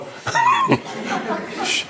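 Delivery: breathy high laugh that falls away; airy laugh. Real, not acted. Amused speaker laughs airily at his story.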